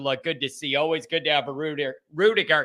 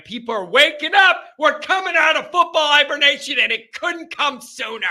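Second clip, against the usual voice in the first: excited; 8 semitones higher, 3 dB louder than usual. Real, not acted. Hyping football season's return.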